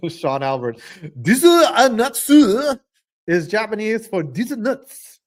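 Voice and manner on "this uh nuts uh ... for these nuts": loud, high hammed-up Japanese accent; mock Japanese accent. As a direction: shout it in a caricatured Japanese accent.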